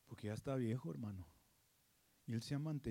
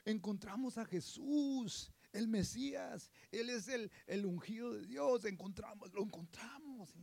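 higher, softer, awed announcement; awed excitement; 7 semitones higher, 3 dB quieter than usual. Acted. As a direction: share a wondrous discovery. Andrew announcing the Messiah. (emoción asombrada)

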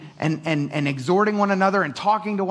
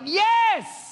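much higher excited cry of Yes!; a church member answering with eager delight. Retold or imitated. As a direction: burst out with joy. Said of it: "You're like".

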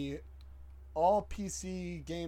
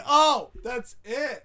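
excited; 7 semitones higher, 10 dB louder, slower than usual. Real, not acted. Excited agreement at joke.

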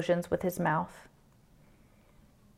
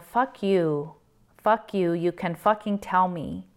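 ordinary delivery

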